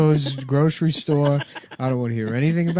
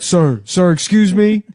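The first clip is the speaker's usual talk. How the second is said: loud, raised calling voice; 6 semitones higher, 6 dB louder than usual. Retold or imitated. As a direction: call after him loudly. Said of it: "They're like".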